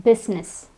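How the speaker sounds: business in two syllables; silent I. Shown as a demonstration. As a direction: say business as BIZ-ness. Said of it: "the I is silent".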